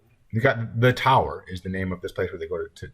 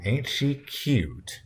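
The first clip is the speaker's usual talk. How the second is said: very slow, higher, stretched-out compliment; strained politeness; 5 semitones higher, much slower than usual. A narrator's reading. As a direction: stretch it out with a polite, tight mouth. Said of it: "stretching her mouth politely".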